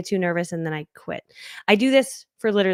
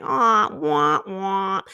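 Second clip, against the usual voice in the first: mock whine; 2 semitones lower, much slower than usual. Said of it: "And I'm like".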